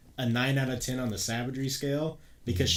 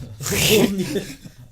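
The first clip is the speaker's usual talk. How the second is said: big burst of laughter; loud laugh; 9 semitones higher, 9 dB louder than usual. Real, not acted.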